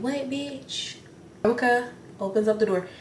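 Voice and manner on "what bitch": mock bitch. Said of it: "You said".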